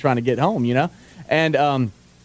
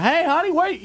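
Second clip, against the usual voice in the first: mock yell. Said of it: "wanted to just yell down".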